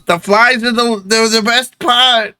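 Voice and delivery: put-on high-pitched kid voice; paperboy child. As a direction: pipe up proudly as a little street urchin. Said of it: "He goes".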